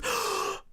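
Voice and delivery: big mock gasp of horror; shocked gasp. Man hears his friend is Hafu, mock horrified gasp. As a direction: suck in air, hammed-up shock.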